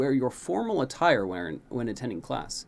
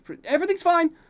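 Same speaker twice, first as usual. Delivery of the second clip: much higher, louder flustered shout; yelled back; 9 dB louder, faster than usual. A narrator's reading. Prince hurrying past the guards, flustered yell. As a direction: call back while running. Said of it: "you yell back".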